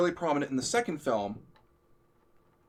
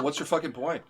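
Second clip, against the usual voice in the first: normal talking voice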